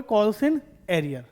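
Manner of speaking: "Calls in arrear" stretched out very slowly, word by word; slow dictation. Shown as a demonstration. Teacher to students, dictating the term very slowly. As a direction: spell out "Calls in arrear" slowly so they can write it. Teacher answering his own question.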